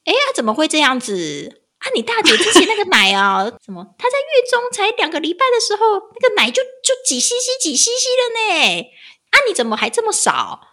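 imitating mom's complaint. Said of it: "他说".

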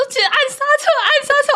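姐姐大喊按刹车; urgent shout. Retold or imitated. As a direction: yell at her to brake. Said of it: "然后她说".

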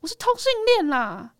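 high-pitched, desperate blurt; blurted confession. Retold or imitated. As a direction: re-enact letting a secret burst out. Retold coming-out outburst in tears.

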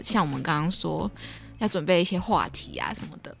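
usual speaking voice

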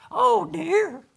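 high, loud dragon dad voice. Shown as a demonstration. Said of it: "声音不一样".

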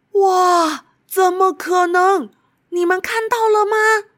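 high, loud amazed wow. Acted. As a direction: gasp at something huge. Amazed at giant fruit.

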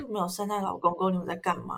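low, quiet scornful dog voice; snooty bored cat. Pretend. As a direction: sneer down at the silly humans. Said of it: "他就在那边耻笑我们说". (低声不屑的狗狗口吻)

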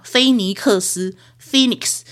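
slow, syllable by syllable: 菲尼克斯, then Phoenix as FEE-niks; careful demo of 菲尼克斯 and Phoenix; slow pronunciation demo. Shown as a demonstration. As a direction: spell out 菲尼克斯 slowly, then Phoenix, like a teacher. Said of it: "你知道她都怎么念吗".

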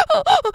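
high imitated crying "啊，啊，啊"; hammed-up crying sounds; mock wailing sobs. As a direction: wail like a weeping mother. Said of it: "在那边哭".

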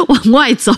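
a rising burst of real laughter into the words; real giggle. Real, not acted. 说自己小时候大胆往外走，忍不住笑出来. Laughing at her own boldness.

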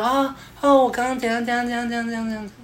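高声轻快、语速加快的女生口吻; 被叫到名字后开心地抢着回答; 愉悦的妹子. Retold or imitated. 被叫名字的妹子愉悦回答. (被叫名字的女生开心地高声快速回答)

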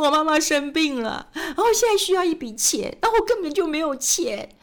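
high, pleading voice of a distressed driver; imitating a taxi driver's tearful plea; whining driver. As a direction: mimic the sobbing driver begging for money. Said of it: "他就跟我说".

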